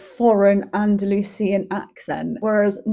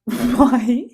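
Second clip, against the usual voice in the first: higher-flung, louder mock-incredulous why; baffled disbelief; 3 semitones higher, 5 dB louder than usual. Customer told tapas stop at half three, in mock disbelief. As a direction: exaggerate your bewilderment. Retelling her reaction to tapas hours.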